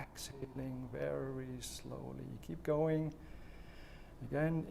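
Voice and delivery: slow, soft breathing-exercise guidance with long pauses; guided breathing. Shown as a demonstration. Coach leading viewers through slow breathing, calm and soft.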